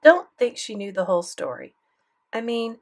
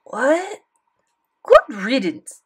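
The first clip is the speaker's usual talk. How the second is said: high, loud puzzled French granny; French grandmother, puzzled; French accent; 5 semitones higher, 7 dB louder, slower than usual. Acted. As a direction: repeat the phrase in a French accent. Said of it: "thick French accent".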